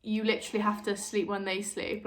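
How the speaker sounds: usual speaking voice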